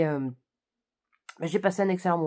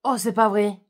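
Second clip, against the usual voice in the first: louder, higher shocked cry; mock exclamation; 4 semitones higher, 9 dB louder than usual. Retelling her cinema reaction to a twist, mock shocked exclamation. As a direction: replay your catchphrase at the plot twist. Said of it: "ma phrase fétiche où j'ai dit".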